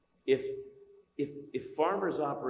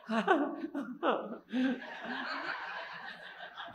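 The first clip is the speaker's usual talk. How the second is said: laughter; 11 semitones higher, 4 dB quieter than usual. Real, not acted. Laughing at own story.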